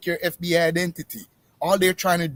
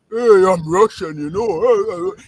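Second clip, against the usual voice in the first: mock Russian accent; 3 semitones higher, 7 dB louder, much slower than usual. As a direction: put on a coarse, booming Russian accent. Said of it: "speak with that deep, coarse voice".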